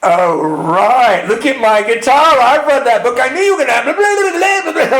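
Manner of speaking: high, loud sarcastic dismissal; mocking brush-off. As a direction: rattle it off in scorn. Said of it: "She leaned back and goes".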